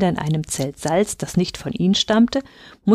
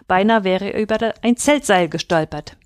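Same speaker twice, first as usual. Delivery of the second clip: usual speaking voice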